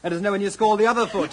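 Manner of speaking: laughing through the joke; bright laughing goodbye; laughing farewell. Acted. Husband teasing the hostess at parting, laughing loud.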